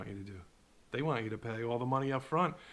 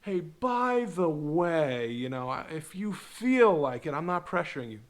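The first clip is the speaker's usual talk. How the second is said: mock sweet guru; 4 semitones higher, 5 dB louder, slower than usual. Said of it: "or they have like".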